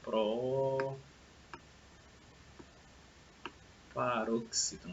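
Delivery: Proparoxítona pulled long, with softened level; drawn-out syllables. Shown as a demonstration. Teacher writing out a long word for pupils, drawn-out syllables. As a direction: stretch Proparoxítona very slowly, quietly, syllable by syllable. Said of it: "aqui é uma palavra proparoxítona".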